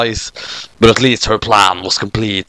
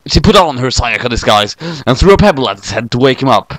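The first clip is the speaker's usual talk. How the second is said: plain reading voice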